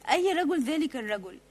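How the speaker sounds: usual speaking voice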